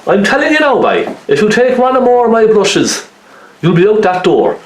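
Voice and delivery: high-pitched, singsong Irish Cork warning; wife's Irish Cork accent. Retold or imitated. Painter mimicking his wife warning him off her brushes, high-pitched Cork accent. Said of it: "that's the way she talks, her Irish Cork accent".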